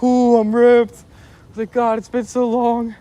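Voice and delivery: high, dopey stoned drawl. Retold or imitated. Telling himself how high he is, dopey stoned. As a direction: play it blissfully baked.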